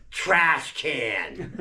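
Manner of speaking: giggling it out through laughter; laughing answer. Retold or imitated. Little brother answering mother, laughing through the words. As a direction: cackle at your own joke.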